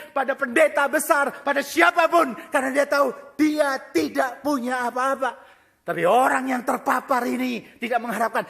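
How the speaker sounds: loud, rising sermon shout; fiery preaching. Acted. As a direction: preach it at full force to the congregation. Preaching at full force.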